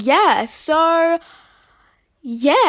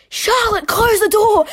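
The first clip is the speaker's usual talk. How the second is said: high, loud panicked-mum yell; loud retold scream at a child; 9 semitones higher, 5 dB louder, slower than usual. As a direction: imitate a mum screaming from the toilet. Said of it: "my mum sitting on the toilet screaming".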